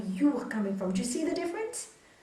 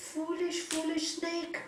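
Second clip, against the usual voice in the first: higher-pitched reproach, building to loud; storyteller's swami telling off, rising to a punchline; chiding swami; 6 semitones higher, 6 dB louder than usual. Acted. Swami to the foolish snake, high chiding that builds.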